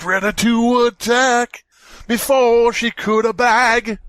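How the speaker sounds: high, slow, loud theatrical narration; hammed-up horror reading. Rereading the creepypasta for friends in a high slow overblown voice. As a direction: read it like an overwrought movie trailer. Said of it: "Reread that properly".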